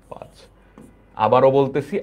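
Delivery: ordinary delivery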